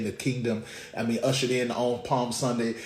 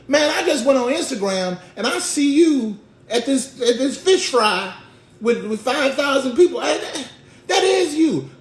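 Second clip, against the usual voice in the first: high, loud comic nagging; insistent accuser; 12 semitones higher, 8 dB louder than usual. Acted. Onlooker confronting Peter with proof, high loud accusing. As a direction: press the evidence in a raised voice.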